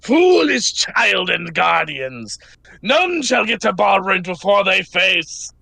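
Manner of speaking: slow, booming proclamation to foolish mortals; menacing monster. Acted. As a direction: drag out each word like an ancient guardian beast. Monster's speech in the game.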